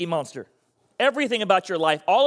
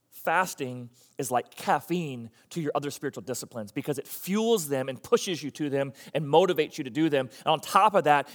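ordinary delivery

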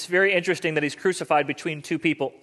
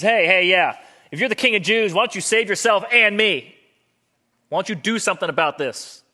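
raised, higher jeering challenge; taunting demand; 5 semitones higher, 5 dB louder than usual. Acted. One crucified thief calls over to Jesus, taunting. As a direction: heckle loudly, then ease off.

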